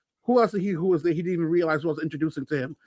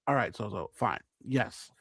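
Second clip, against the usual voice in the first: lower, softer resigned yes; resigned quiet giving-in; 10 semitones lower, 12 dB quieter than usual. Retold or imitated. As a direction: give in grudgingly, softly. Giving in to Sozo.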